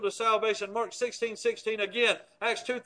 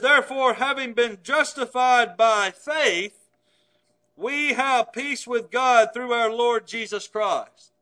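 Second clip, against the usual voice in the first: slow, deliberate reading of the verse; read slowly; 5 dB louder, slower than usual. Shown as a demonstration. Preacher reads the verse slowly to the congregation, measured and deliberate. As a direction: lay out each word slowly and evenly. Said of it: "I'll read it slowly".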